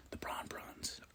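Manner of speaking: breathy whispered name; whispering the Braun Brawns' name; whispered aside. Real, not acted. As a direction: whisper it like a secret.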